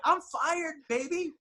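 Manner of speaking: high, giddy mock celebration; giddy I'm fired. Comedian to podcast host, gleefully announcing his own firing. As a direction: sing it out high like you don't care anymore.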